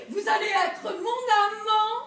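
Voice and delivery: high, insistent order; imperious demand. Acted. Wife ordering Roger to become her lover, high imperious demand.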